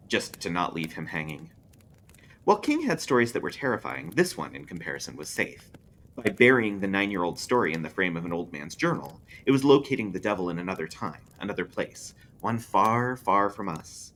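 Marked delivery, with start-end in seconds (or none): none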